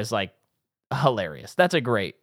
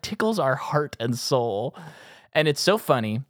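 usual speaking voice

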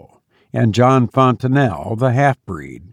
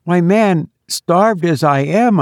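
higher-pitched, lively protest; animated, pitched-up ribbing; astonished exclamation; 7 semitones higher than usual. A narrator's reading. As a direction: exclaim it to a friend in disbelief. Hagedorn teases the glum bridegroom.

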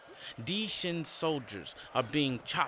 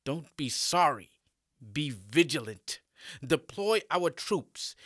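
raised voice, stern orders; stern emperor commanding; 4 dB louder than usual. Acted. Emperor commands his general.

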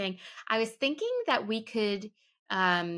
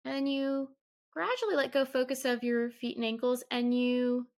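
calm, measured meditation guide with long pauses; softer than chat, unhurried and evenly paced; guided meditation; 2 semitones higher, 3 dB quieter than usual. Acted. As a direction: ease the listener gently through a body scan. Leads a guided mindfulness meditation.

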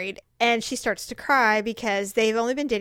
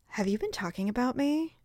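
hushed, serious confrontation; softly retold challenge; mock serious; 7 dB quieter than usual. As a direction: quietly demand an answer, deadly serious. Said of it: "got really, like, deep and serious".

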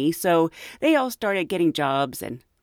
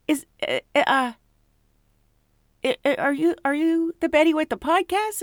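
high, hesitant fan voice; starstruck fan; 9 semitones higher than usual. Retold or imitated. Fan asking Betty if she's the podcast host, high and hesitant. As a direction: stammer it out like a shy fan. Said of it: "she says".

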